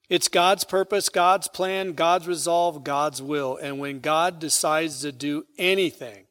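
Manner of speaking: loud, ringing pulpit cadence, hitting each God; booming scripture read from the pulpit; preaching at full force. Acted. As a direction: proclaim it to the back pews. Emphatic list of God's will.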